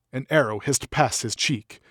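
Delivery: ordinary delivery, nothing marked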